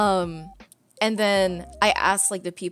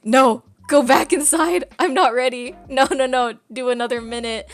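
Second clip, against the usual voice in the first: higher, louder frantic begging, slowed down; panicked pleading; 5 semitones higher, 6 dB louder than usual. Retold or imitated. Nervous fan begs the last fan to stay inside, panicked plea. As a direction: beg them to stay in, not ready.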